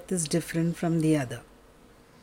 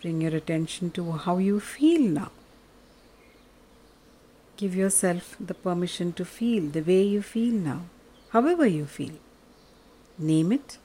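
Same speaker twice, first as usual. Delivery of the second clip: soft, lower, unhurried meditation voice; guided meditation; 4 semitones lower, 5 dB quieter than usual. Acted. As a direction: guide them gently into stillness.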